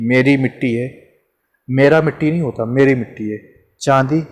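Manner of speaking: normal talking voice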